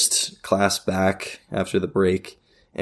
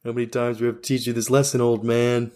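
taunting villain; 4 semitones higher than usual. Pretend.

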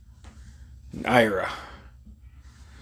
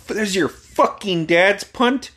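higher, slow disbelief; shocked friend; 7 semitones higher, slower than usual. Retold or imitated. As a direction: drag it out in shock. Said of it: "he's like".

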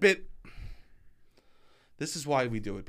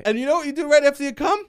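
excited; 13 semitones higher, 7 dB louder than usual. Pretend. High loud tease.

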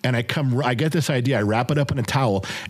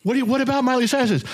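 daughter's excited squeal; 11 semitones higher, faster than usual. Retold or imitated.

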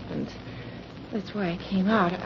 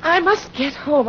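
louder, higher anxious plea; urgent pleading; 5 semitones higher, 4 dB louder than usual. Acted. Girl pleading with the detective to get home, urgent. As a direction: beg to be let go home.

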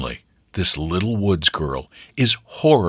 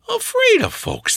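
put-on high rustic old woman; lightly voiced country grandmother; grandmother's voice. A narrator's reading. Grandmother explaining Sylvia to a neighbour, high rustic voice. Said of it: "they said".

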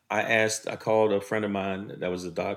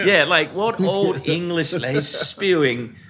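mocking disbelief; 7 semitones higher, 4 dB louder than usual. Real, not acted. Mocking teacher's word.

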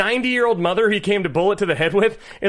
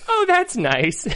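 put-on old mother; 8 semitones higher, much slower than usual. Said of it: "I love her reaction".

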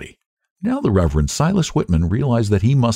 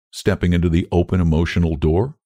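usual speaking voice

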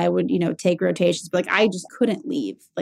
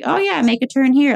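higher-pitched, breezy stalling; feigned casual request; 7 semitones higher, 4 dB louder than usual. Retold or imitated. Stalling a friend who's driving her home, feigned casual. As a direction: toss it off high to put off going home.

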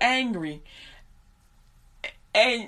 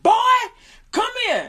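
stern father call; 12 semitones higher, 7 dB louder, faster than usual. Retold or imitated. Father summons son.

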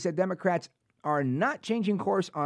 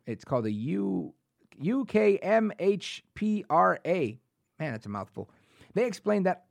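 ordinary talk, nothing marked

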